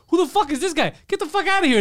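high, loud yelling at the intruder; imitating an angry Japanese editor shouting; mock angry yell. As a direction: bark him out of the room, hammed-up. Angry newspaper man yelling at outsider.

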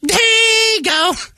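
high mock shout calling out; husband mimics his wife yelling in the store; imitating wife shouting. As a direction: squeal the name like a wife yelling across the store. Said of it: "suddenly she just shouts out".

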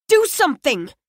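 high, urgent plea; desperate plea. Acted. Narrator begging Spingate to help, desperate. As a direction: demand she act now.

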